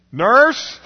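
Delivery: loud, high panicked shout; calling for help. Retold or imitated. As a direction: yell urgently for the nurse.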